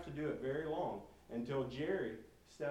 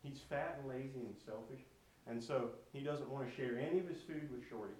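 plain reading voice

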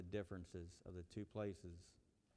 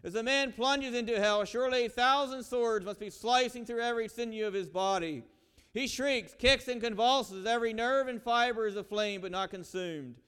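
louder, higher, gripping preacher's tale of hell; dramatic sermon storytelling; 11 semitones higher, 14 dB louder than usual. Acted. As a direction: tell the hell story at full dramatic force.

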